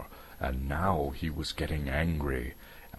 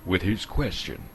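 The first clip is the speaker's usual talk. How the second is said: usual speaking voice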